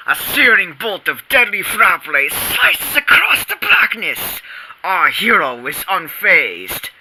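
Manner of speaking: usual speaking voice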